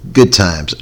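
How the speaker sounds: usual speaking voice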